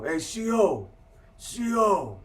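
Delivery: retold holler for the C O; calling out. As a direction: shout for the guard, rising. Inmate calling the guard over.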